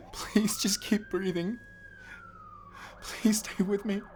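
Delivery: crying over a bleeding friend; tearful plea. Acted. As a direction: beg her through tears. Begging dying friend.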